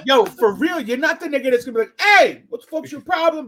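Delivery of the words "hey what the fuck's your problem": mock yell. Imitating an aggressive guy.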